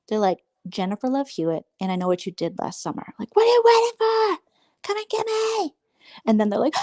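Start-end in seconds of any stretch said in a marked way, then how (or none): 3.4-5.7 much higher, sing-song dare; mock horror taunt. Mocking the ladies as a horror heroine, squeaky taunt. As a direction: taunt like a silly scream queen.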